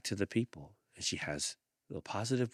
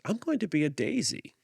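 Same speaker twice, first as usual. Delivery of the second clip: put-on much higher, bright little-girl squeal; squeaky little friend; 13 semitones higher, 6 dB louder than usual. A narrator's reading. One little friend tells another, much higher and excited. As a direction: pitch it way up like a tiny girl.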